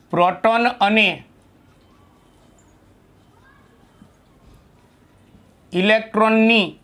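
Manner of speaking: phrase by phrase, with very long pauses; dictation. Real, not acted. Teacher giving students a short note to write down, dictating. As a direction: dictate in small chunks, waiting while they write.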